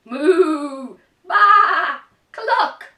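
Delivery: very loud, high moo, baa and cluck; loud animal noises. Acted. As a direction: blast the animal cries to scare him. Animals scare farmer from bed.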